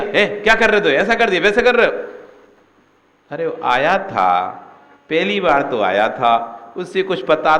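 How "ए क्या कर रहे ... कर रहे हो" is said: high, loud sharp rebuke; angry scolding. Retold or imitated. Disciple scolds a first-time temple visitor, angry. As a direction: snap at the newcomer. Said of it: "तुमने उसको टोक दिया".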